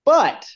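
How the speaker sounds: "But" shot out high and loud; one loud, high "But," then a pause; dramatic turn. Real, not acted. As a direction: punch "But" as a cliffhanger.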